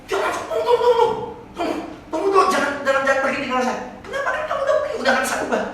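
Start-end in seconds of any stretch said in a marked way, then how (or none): 0.5-3.9 high, louder, panicky pleading; the man begging his guide not to leave him in hell; panicked plea. Acted. 4.0-5.6 mocking reply.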